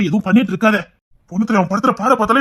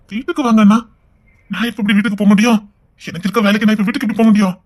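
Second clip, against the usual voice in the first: ordinary delivery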